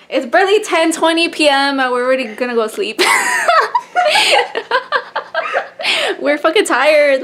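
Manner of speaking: loud laughing rant about being tired; loud giggly complaint; laughing loudly. Real, not acted. Laughing about going to bed.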